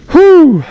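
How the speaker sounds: loud, high "whew"; high whoop. Real, not acted. Angler thrilled by flathead catch, loud high whoop. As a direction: let out a big high whoop.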